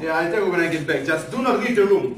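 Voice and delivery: loud, high and hurried; urgent warning. Acted. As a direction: call out an urgent order on the way out. Rushing off, urgent order.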